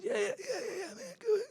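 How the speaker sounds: high, rushed eager yeses. Retold or imitated. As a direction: imitate an overeager Bruce saying yes. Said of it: "He said".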